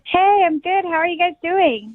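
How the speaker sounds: high, chipper phone greeting; perky hello. Real, not acted. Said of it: "you sound so chipper".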